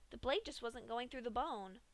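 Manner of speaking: usual speaking voice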